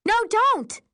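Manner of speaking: high, loud cry of warning; urgent shout. A narrator's reading. Narrator tries to stop Carly drinking, urgent shout. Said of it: "I shout".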